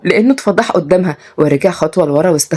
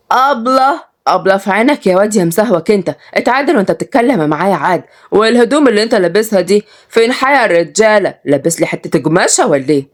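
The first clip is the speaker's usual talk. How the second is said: indignant village woman; 2 semitones higher than usual. Acted. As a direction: tell off a cheeky boy in Sa'idi style. Voices Salma scolding. (صعيدية زعلانة بتوبخ)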